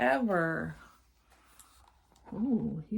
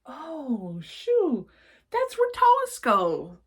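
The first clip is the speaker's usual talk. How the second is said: put-on much higher, louder childish voice; little sister mishearing shoe; 7 semitones higher, 8 dB louder, much slower than usual. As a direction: chirp it like a small child.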